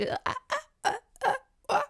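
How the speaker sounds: strangled high uh-uh sounds; mock choking on stage; choked stammer. Berna bombing her audition, strangled stammer. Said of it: "she's just choked".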